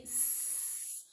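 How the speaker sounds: "s" as a long unvoiced hiss; just hiss the "s", no voice. Shown as a demonstration. Teacher demonstrating the S sound, a long soft hiss. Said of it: "o S".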